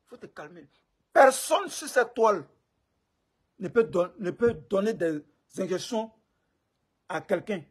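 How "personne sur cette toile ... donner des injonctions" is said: forceful emphasis. Real, not acted. Angry reply to online critic.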